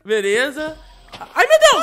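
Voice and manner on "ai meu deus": high, loud scared yelp; startled cry. Real, not acted. Player yelps at almost falling in the hole, startled. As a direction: shriek it as you nearly fall.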